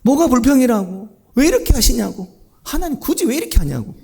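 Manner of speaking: high-pitched, protesting grumble; indignant acted complaint; complaining Jonah. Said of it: "막 계속 불평인 겁니다".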